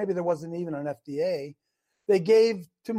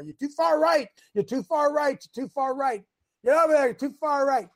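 mock crowd shouting from the stands, higher and louder; crowd yelling; 6 semitones higher, 4 dB louder than usual. Pastor voicing the whole ballpark yelling you're wrong, hammed-up shout. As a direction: heckle him like fans along the foul line. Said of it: "look how many people we have yelling at you".

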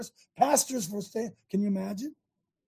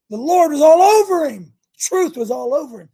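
much higher and louder, proclaimed; preaching fervor; 6 semitones higher, 9 dB louder than usual. Real, not acted. Preaching climax.

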